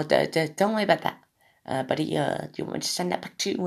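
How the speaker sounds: high, softened imitation Australian; put-on Australian accent. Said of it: "he says the same thing each time".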